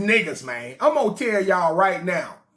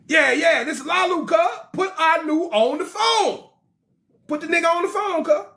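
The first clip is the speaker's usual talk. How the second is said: hyped-up caller; 6 semitones higher than usual. Acted. Olalu calls Anu.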